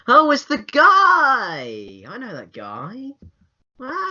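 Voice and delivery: high, drawn-out mock delight of a mum; exaggerated high-pitched audience wonder. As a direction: coo slowly like a mum spotting a familiar actor. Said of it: "it's just for the mums in the audience to go".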